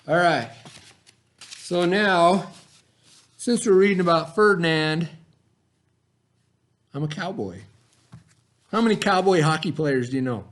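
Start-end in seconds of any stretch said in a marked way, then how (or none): none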